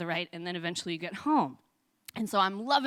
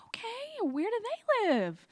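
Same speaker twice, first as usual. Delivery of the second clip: put-on patient old woman; slower than usual. Old woman asks lost child.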